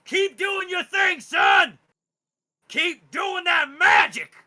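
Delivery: loud, high, slow encouraging shout; cheering father. Acted. Father urging his son to keep using magic, shouting high. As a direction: cheer him on across the field. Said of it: "He seems to be like".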